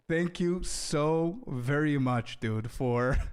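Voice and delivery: each word stretched out slowly; slow drawn-out thanks. Real, not acted. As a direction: draw out the thanks, slowly and mockingly.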